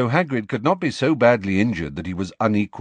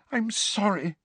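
high, soft apology; apologetic, aghast; 11 semitones higher, 5 dB quieter than usual. A narrator's reading. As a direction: apologise in a small, high-pitched voice. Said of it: "said Hermione with her hands over her mouth".

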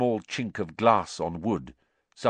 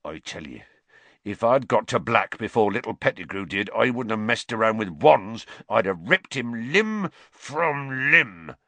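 menacing growl that swells; 5 semitones higher, 5 dB louder than usual. A narrator's reading. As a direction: growl a threat, slowing hard at the end. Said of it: "Hagrid growled".

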